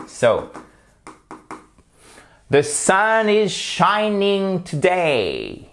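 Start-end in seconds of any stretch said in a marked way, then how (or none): 2.5-5.7 "the sun is shining today" très lent, haut, SUN et SHI étirés; exagère les accents de "sun", "shining", "today"; lecture modèle accentuée. Shown as a demonstration. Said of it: "Je vais vous lire les phrases". ("the sun is shining today" chantant, qui retombe à la fin)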